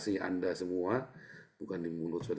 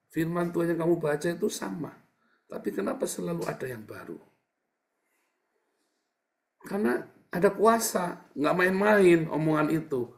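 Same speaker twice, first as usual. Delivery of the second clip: high, loud preaching climax; preaching at full force; 10 semitones higher, 8 dB louder than usual. Acted. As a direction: proclaim it, rising to a cry. Preacher raises voice on power. (khotbah berapi-api, suara tinggi dan keras)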